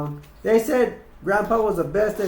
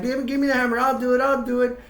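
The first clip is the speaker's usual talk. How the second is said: eager hitchhiker; 7 semitones higher, 3 dB louder than usual. Retold or imitated. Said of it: "the hitchhiker's like".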